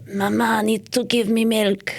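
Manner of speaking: slow, mock-Russian baby voice; baby with Russian accent. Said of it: "sounded like your dog".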